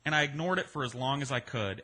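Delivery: usual speaking voice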